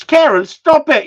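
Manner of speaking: loud, high mock scolding of Kieran; teasing shout. Real, not acted. Teasing Kieran in chat with a loud, high mock scold. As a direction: call him out loud and high, half laughing.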